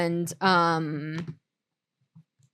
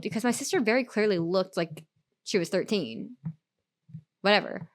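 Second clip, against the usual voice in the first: ordinary delivery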